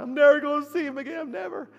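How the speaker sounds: high-pitched mock wailing; hammed-up tearful lament, far above speaking pitch; imitated crying. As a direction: wail it like a grieving man. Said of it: "And he's crying".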